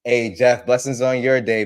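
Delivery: ordinary talk, nothing marked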